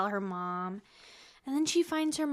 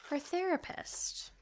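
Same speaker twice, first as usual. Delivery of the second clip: normal talking voice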